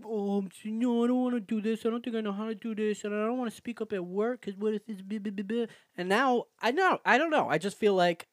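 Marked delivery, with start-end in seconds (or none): 0.0-5.7 high, mocking anxious inner voice; whiny self-doubt. Podcaster mimicking their own insecure thoughts, whiny self-doubt. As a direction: whine through the worries in a raised voice.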